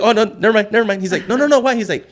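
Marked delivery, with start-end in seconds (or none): 0.0-1.0 high, loud, hurried-sounding retreat; panicked backpedal. Retold or imitated. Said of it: "Fuck, I'm panicking". 1.3-1.8 high, loud mock insistence; insistent questioning. Retold insistent questioning after backpedal.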